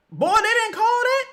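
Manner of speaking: high, loud cry of disbelief at the refs; outraged disbelief. Real, not acted. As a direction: yell it at the referee in disbelief. No foul call by refs.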